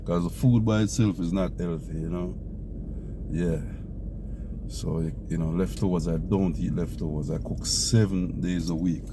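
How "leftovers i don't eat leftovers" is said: normal talking voice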